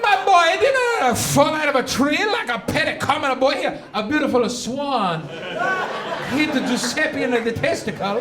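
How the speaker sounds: proud Italian parent topping the neighbours; mock Italian boast. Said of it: "Everybody's like".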